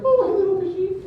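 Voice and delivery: put-on high coaxing baby-talk to a fish. Comedian coaxing a fish underwater, high sweet baby-talk. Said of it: "I'm like".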